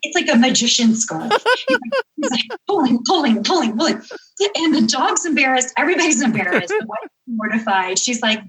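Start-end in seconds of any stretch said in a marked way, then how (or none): none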